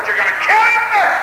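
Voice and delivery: high-pitched cry of warning; startled, stretched-out outcry; retold alarm. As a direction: holler it in mock alarm at the child.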